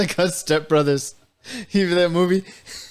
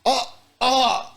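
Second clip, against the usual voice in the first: high comic moaning; exaggerated high-pitched gasping oh; mock climax moans; 12 semitones higher than usual. Imitating Dale on his first time, hammed-up moans.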